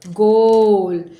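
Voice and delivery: ज़ोर से, धीमे खींचकर "गोल"; गोल को लंबा और ऊँचे स्वर में कहो. Shown as a demonstration. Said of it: "गा ओ गोल". (बच्चों को पढ़ाते हुए ज़ोरदार खिंचा शब्द)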